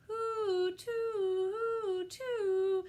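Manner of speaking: high two-tone siren wail; siren hoo-too. A narrator's reading. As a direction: sing out the fire siren. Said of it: "it grew more and more noisy".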